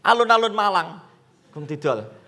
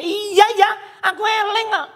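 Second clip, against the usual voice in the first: high, testy mock retort; somewhat rude; 8 semitones higher than usual. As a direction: imitate a debtor getting short with the lender. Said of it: "Rada kasar".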